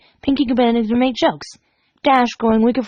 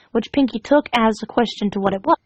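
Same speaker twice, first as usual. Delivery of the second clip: ordinary delivery, nothing marked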